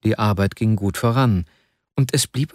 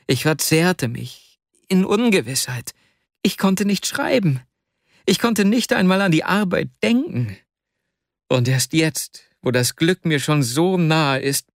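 put-on higher, anguished young man confessing; Wasja in anguish; 6 semitones higher than usual. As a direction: plead guilty with a strained high voice.

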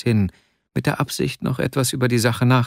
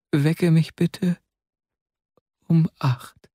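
higher voice drifting towards sleep; halting, drowsy, with long gaps between phrases; exhausted, barely speaking; 4 semitones higher than usual. A narrator's reading. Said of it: "Er konnte kaum sprechen". (schläfrig, stockend, mit höherer Stimme)

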